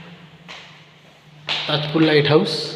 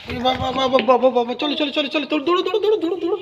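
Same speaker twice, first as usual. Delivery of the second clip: excited calling; 10 semitones higher, much faster than usual. Real, not acted. Urging child to run playfully.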